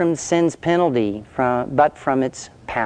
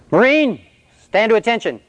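drill sergeant bark; 8 semitones higher, faster than usual. Retold or imitated. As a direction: snap the order at a recruit. Drill sergeant barks an order.

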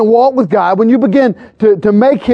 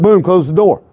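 ordinary delivery

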